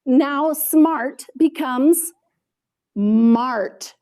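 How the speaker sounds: "smart becomes" drawn out slowly before "mart"; slow suspense. Shown as a demonstration. Teacher showing a deleted sound to students, slow and drawn out. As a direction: hold the moment before the reveal. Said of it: "delete the beginning sound".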